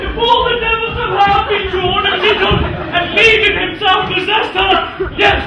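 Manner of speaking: loud, high, laughing bellow; rowdy mocking shout. Sir Toby barges in shouting and laughing at Malvolio's madness. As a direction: roar it out, half laughing at the madman.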